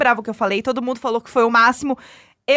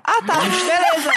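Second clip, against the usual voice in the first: mock offended; 6 semitones higher, slower than usual. Feigned offense at being left out.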